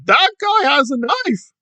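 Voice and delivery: high, whiny alarmed yelling about a knife; mock panicked shouting. Commentator mocking the activist's panic, high whiny yells. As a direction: ham up a panicked man crying knife. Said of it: "starts shouting and whining".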